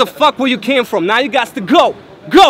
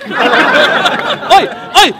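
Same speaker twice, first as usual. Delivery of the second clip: hammed-up British accent, higher and louder; mock British accent; 3 semitones higher, 5 dB louder, slower than usual. Mocking his British opponent, loud mock British accent. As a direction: bark it like a cartoon British lad, British accent. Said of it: "my British accent impression".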